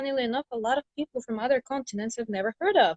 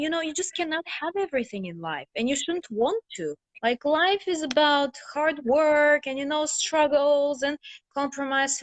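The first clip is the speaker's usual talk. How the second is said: higher-pitched retold parental lecture; parents' lecture; 6 semitones higher than usual. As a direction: lecture like a parent laying down life's rules. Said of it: "my parents would always tell me".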